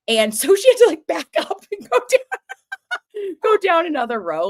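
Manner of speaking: can't keep a straight face recounting it; laughing while telling. Real, not acted. Laughing at Jen's parking.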